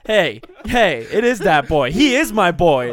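loud, laughing cheer of recognition; excited shout. Real, not acted. Cheering the Dat Boy character to friends, loud.

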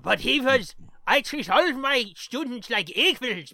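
put-on loud, high German professor accent. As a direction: lay on a thick German accent. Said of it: "Don't you like my German impression?".

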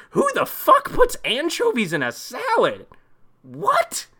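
rising, shrill disbelief at anchovies; incredulous outrage. Real, not acted.

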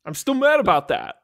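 high goofy put-on voice, laughing; [name] accent. [name] taunting Matt in a high goofy accent. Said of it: "Nobody likes this accent".

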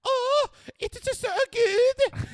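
very high, loud, drawn-out mock swoon; squealing parody of anime ecstasy; exaggerated ecstasy. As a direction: wail it in over-the-top food bliss. Acting anime girl's ecstasy over food.

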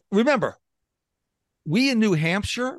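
normal talking voice

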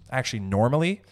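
ordinary talk, nothing marked

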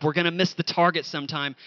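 usual speaking voice

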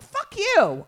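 loud, high mock outburst; hammed-up indignation; mock indignant retort. Acted retort to the young people.

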